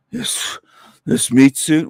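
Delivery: exaggerated breathy sigh, then the words; mock heavy sigh. Mocking the streamer's heavy breathing, a big sigh. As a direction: ham up his panting. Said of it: "and you're".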